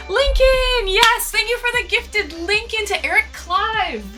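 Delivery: high, loud, happy shout-out; excited thanks. Real, not acted. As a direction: call out the gift with joy. Thanking a gifted sub.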